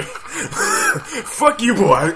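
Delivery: loud, high curse through laughter; laughing defiance. Real, not acted. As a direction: laugh as you shout it high. Laughing while fleeing the driver.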